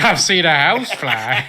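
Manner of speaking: sing-song crow line half-laughing. Retold or imitated. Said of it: "those three crows would be like".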